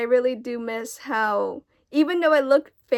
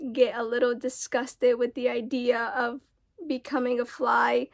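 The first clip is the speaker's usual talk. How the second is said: ordinary talk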